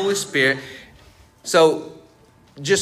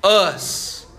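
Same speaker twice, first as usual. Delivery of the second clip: repeated louder for the crowd; 5 semitones higher, 3 dB louder than usual. Real, not acted. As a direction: say it back louder, punching it. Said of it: "Say it louder".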